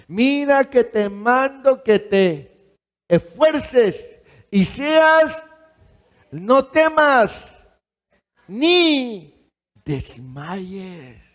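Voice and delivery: loud, high, slow proclamation; proclaimed verse. Shown as a demonstration. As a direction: declare the true verse with force. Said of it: "Así dice la palabra".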